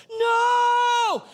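loud high cry of no; kid yelling no. Retold or imitated. Kid losing at Uno, yelling no. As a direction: shout it like a kid losing at cards. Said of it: "I recognize his voice".

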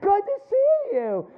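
slow, high, syrupy welcome; fake sweet greeting. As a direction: smile wide and pretend to be glad. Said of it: "I got this big smile".

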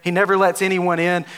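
normal talking voice

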